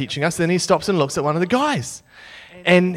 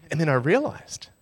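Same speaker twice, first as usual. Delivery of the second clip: usual speaking voice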